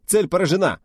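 high, loud snappy report; brisk reply. Acted. Officer reporting to the test leader, loud brisk reply. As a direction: rap it out smartly.

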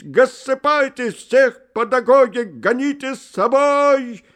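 guttural burred r, high, slow bellow; burred r command. Acted. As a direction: bark orders with a guttural burr. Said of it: "неслыханным картавым голосом".

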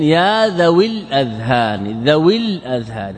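ذوي الأذهان run together with the yā' dropped; ya elided. Shown as a demonstration. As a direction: link ذوي straight into الأذهان with a short i, no long ī. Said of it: "إذن الياء هنا محذوفة". (وصل ذوي بالأذهان مع حذف الياء نطقًا)

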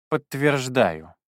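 slow, strained confirmation; forced. Acted. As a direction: force the word out with effort. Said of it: "с усилием воли произнёс Лу".

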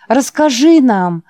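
growing plea. Acted. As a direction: press the request harder.

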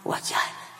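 whispered aside to the congregation; hushed. Acted. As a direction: drop to a whisper on the last word. Preacher hushes over the slapped face.

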